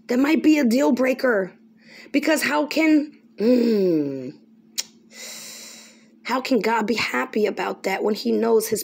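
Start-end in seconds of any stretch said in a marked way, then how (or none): none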